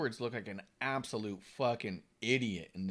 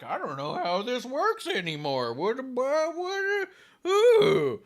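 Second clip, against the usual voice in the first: confused referee; 12 semitones higher, 8 dB louder, much slower than usual. Retold or imitated. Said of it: "looking at him like".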